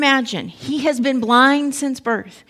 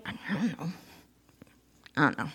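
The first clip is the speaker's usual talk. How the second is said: frightened mumble; 6 semitones lower, 13 dB quieter than usual. Retold or imitated. Frightened parents dodging the question.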